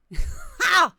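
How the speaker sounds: sudden loud, high burst of laughter; one explosive, astonished "ha"; amazed laugh. Real, not acted. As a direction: let out a short high laugh. Amazed at the voice demo.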